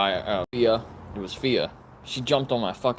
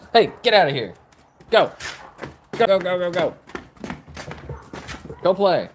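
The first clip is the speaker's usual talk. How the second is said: raised, sharp-edged order; shooing; 6 semitones higher than usual. Real, not acted. Shooing the dogs away.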